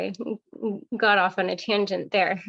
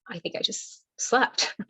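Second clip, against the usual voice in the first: whispered thought; 4 semitones higher than usual. Retold or imitated. Retold own confused thought.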